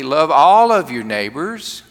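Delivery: each word weighed out slowly; a firm preacher's plea. Acted. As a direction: lean hard on every word. Pastor restates his teaching.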